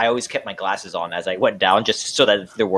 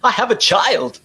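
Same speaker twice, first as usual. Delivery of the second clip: high, loud pleading voice; mock desperate plea; 11 semitones higher, 4 dB louder than usual. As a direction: beg to be let off the ship, hammed-up. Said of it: "the guy on the Titanic that's like".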